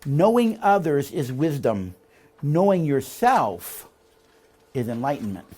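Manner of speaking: slow, weighty reading with a pause on each phrase; slow quote reading. Shown as a demonstration. Teacher reading a quote to the class, slow and deliberate. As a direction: let every word of the quote land. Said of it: "Listen to this quote".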